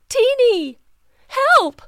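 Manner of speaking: high, loud desperate call; cry for help. Acted. Mama toad calling Teeny for help, high desperate cry. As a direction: call out for your child from afar.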